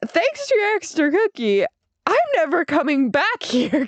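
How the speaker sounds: high, laughing mock complaint; mock mortified. TikTok retelling to the clerk, high laughing mock huff. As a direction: squeak it out, laughing at yourself. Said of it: "I was just like".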